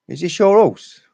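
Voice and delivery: hammed-up English punter asking; loud question. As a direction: put the question loudly and brightly. Englishman asks Welshman.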